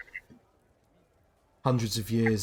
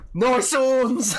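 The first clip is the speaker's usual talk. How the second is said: much higher, louder sneering jab; mocking drunk; 14 semitones higher, 8 dB louder than usual. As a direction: taunt him like a drunk bully. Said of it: "and then just says".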